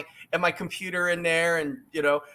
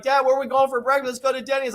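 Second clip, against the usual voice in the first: put-on kid's voice; 7 semitones higher, faster than usual. Voicing his kid asking.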